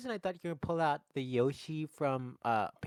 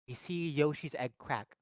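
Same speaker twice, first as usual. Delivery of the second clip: ordinary talk, nothing marked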